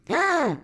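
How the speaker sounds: high startled gasp; sharp gasp. Real, not acted. Reader gasping at Fandroid's takeover, shocked. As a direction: gasp at the twist.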